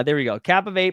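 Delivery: ordinary talk, nothing marked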